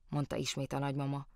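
ordinary delivery

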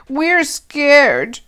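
put-on high, loud frightened child; a child pleading, scared of the storm. A narrator's reading.

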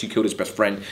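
ordinary talk, nothing marked

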